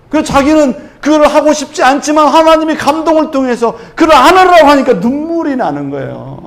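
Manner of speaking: loud, high, impassioned preaching; full-force preaching. Acted. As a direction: preach it out, building with fervour. Fervent peak about God's prompting. (힘차게 외치는 설교)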